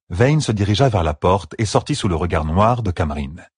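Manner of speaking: ordinary delivery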